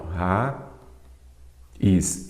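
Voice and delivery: raiz split as "ra... is", long pause between; syllable by syllable. Shown as a demonstration. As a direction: say "ra", pause, then "is". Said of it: "vamos separar aqui". ("ra, is" separado em duas sílabas, com pausa)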